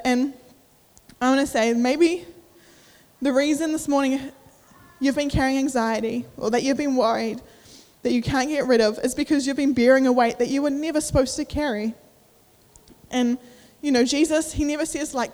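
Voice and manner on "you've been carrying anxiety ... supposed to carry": normal talking voice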